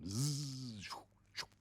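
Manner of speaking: buzzing electric razor sound then a hissed shoo; imitated razor buzz. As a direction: mimic a razor whirring.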